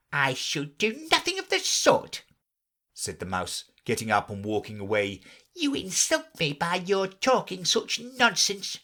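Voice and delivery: stalk off in a huff; offended mouse. A narrator's reading. Insulted mouse leaving Alice, indignant.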